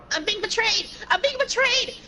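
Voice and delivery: outraged gamer. Real, not acted. Gamer yelling at teammates who betrayed him, outraged. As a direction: yelp at the screen as teammates turn.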